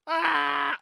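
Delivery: loud high scream; sudden full-volume yell; excited game scream. Pretend. Excited player jumping the start, screamed. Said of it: "I get so excited".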